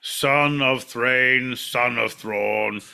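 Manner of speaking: slow, drawn-out royal proclamation; dwarf king. Acted. King under the mountain declares his lineage, slow proclamation. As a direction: announce the lineage grandly, stretching each title.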